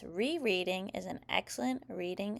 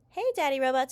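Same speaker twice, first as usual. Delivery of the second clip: put-on high, louder kid's call; child calling out; 6 semitones higher, 6 dB louder, faster than usual. A narrator's reading. Child summons Daddy Robot to help, calling out. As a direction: call Dad over in a child's voice.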